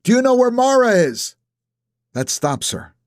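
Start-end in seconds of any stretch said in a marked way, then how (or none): 0.0-1.3 calling across the driveway, high and loud; shout. A narrator's reading. Nap shouts after Ellie at her car, loud and high. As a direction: yell to stop her before she drives off. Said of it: "when I shout".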